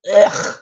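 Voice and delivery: loud throaty noise of disgust; revolted groan; disgusted ugh. Real, not acted. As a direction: let out a short, rasping "ugh". Disgust at a photo.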